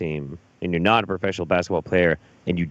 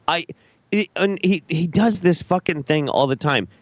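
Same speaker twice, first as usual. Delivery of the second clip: ordinary talk, nothing marked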